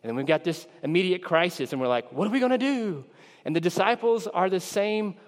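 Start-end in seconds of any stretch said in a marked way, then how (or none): 2.1-3.0 high-pitched helpless wail in words; mock panic. Believers facing an immediate crisis, mock panicked. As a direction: throw up a hammed-up, panicky question. Acting people in sudden crisis.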